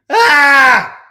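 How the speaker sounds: loud, high scream of rage; short furious shout; frustrated scream. Real, not acted. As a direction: let out a real angry yell. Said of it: "I could just scream".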